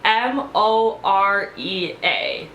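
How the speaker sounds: letter-by-letter mock spelling of M O R E A; spelling it out slowly. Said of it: "She goes".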